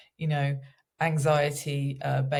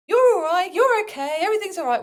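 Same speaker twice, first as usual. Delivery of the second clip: very high, loud sing-song reassurance; over-the-top cooing at a little one; parent soothing a child; 6 dB louder, faster than usual. Acted. Parent getting a refusing child to school, high-pitched false reassurance. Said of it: "which you might say to a child".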